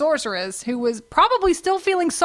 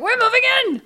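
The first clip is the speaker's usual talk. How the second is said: excited villain; 11 semitones higher, 3 dB louder, faster than usual. Retold or imitated.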